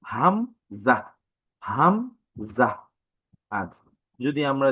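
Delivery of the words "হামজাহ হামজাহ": হামজাহ slowly from the throat; slow demo of হামজাহ; throat sound demo. Shown as a demonstration. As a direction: show where হামজাহ starts in the throat. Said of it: "এই হরফটা উচ্চারণ হয়".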